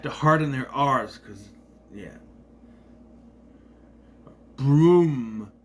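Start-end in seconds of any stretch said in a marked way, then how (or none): 4.5-5.5 loud "Broom" with an odd misplaced R; New Zealand-style "Broom" in a half-mock voice; mock accent R. Shown as a demonstration. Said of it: "when they do their R's on the wrong side".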